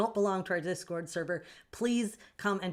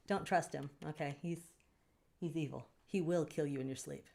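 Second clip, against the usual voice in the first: ordinary talk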